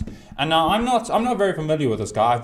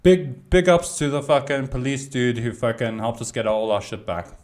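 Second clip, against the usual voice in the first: ordinary talk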